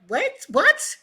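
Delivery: high, loud incredulous double take; retold disbelief. Coach hearing colleague can't find anyone, incredulous. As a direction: blurt it in mock astonishment.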